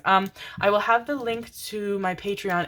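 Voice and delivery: ordinary talk, nothing marked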